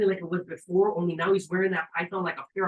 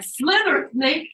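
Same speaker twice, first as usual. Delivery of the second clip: much higher, loud cheer; root for the snake, full voice; urging shout; 11 semitones higher, 8 dB louder, much slower than usual. Retold or imitated. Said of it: "like, like".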